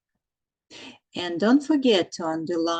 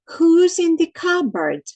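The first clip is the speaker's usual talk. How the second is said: "Who's" loud and high; teacher stresses "Who's" in "Who's in the cupboard?"; emphatic question; 9 semitones higher, 6 dB louder than usual. Shown as a demonstration. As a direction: call out "Who's" to the class. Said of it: "Once again, who?".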